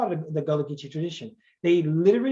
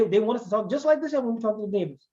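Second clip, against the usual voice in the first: normal talking voice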